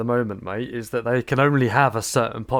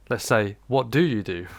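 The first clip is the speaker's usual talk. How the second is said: ordinary talk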